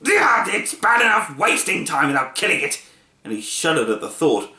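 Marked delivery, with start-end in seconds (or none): 0.0-2.8 shouted scolding watchdog; angry dog voice. A narrator's reading. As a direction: bark out the rebuke. Furious watchdog lecturing Milo.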